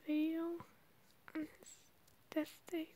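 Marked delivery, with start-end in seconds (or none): none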